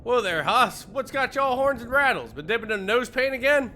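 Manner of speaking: hammed-up Western cowboy; cowboy drawl. Reading Gabby's game lines as a loud Western cowboy. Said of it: "Gabby doesn't talk like that".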